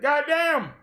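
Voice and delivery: high, loud Goddamn!; a hammed-up startled Goddamn!; mock exclamation. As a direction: blurt it out in mock shock. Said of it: "and said".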